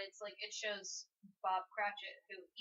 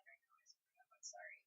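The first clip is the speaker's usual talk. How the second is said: very soft, near-whispered sorry; hushed apology; 17 dB quieter than usual. Acted. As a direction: say it meekly, almost without voice. Acting out Scrooge's humble apology.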